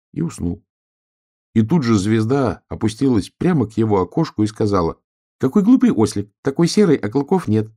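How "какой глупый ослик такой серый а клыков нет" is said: high, loud taunting star voice; scornful star. A narrator's reading. Star scolding a silly fangless donkey, high scornful voice. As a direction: chide someone in a high voice.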